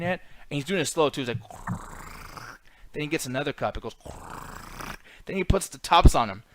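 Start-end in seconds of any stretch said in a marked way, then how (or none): 1.4-2.5 imitated pouring noise. Imitates Denzel pouring coffee slowly. 4.0-4.9 imitated pouring noise. Imitates another pouring sound.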